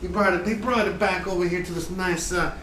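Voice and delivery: slow, lightly Southern cooking-show voice; hint of a Southern chef bragging; Southern drawl. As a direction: spin a tall tale in a mild Southern drawl. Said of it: "southern drawl".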